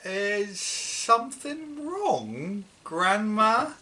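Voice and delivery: put-on slow, polite small boy voice. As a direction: ask gently and carefully like a worried boy. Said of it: "George asked her politely".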